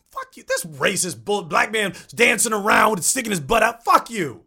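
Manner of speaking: loud, high, furious rant; angry outburst. Retold or imitated. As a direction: yell it like you're fed up. Retells angrily refusing a sketch.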